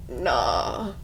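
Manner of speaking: low, blunt brush-off. Retold or imitated. Joking Legend brushing off her wish for love, dismissive. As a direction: drop the voice and shrug it off. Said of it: "And he's like".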